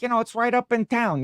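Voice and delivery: high-pitched imitated Italian-American cousin; casual directions in a light Italian accent. Cousin gives Jill directions, light Italian accent. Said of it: "a real, like, you know, Italian accent".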